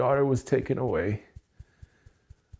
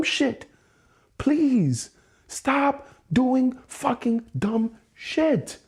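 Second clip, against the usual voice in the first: high, strained, pleading voice, almost cracking; exasperated pleading; 9 semitones higher, slower than usual. Real, not acted. Venting alone about his partner's debts, high, exasperated pleading. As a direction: beg her to stop, voice pushed up high in frustration.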